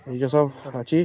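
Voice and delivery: muffled through a helmet; inside a helmet. Real, not acted. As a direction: speak from inside a full helmet. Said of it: "helmet পরে আছি".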